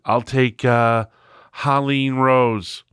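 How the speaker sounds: slow, drawn-out delivery, stretching the name; imitated former boss. Telling a draft story about his old boss, slow drawling imitation. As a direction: drag the words out like the old boss. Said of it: "he used to talk like this".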